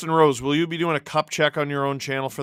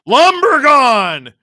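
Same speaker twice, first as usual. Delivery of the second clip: long, loud, high holler; shouted call; 9 semitones higher, 13 dB louder, much slower than usual. Real, not acted. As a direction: bellow the name, stretched out. Shouts out during live play.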